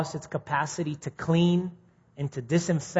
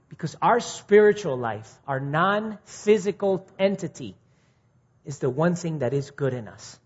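usual speaking voice

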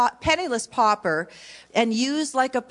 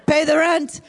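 mustache twirling villain; 5 semitones higher, 5 dB louder than usual. Acted. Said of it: "the mic or the mustache".

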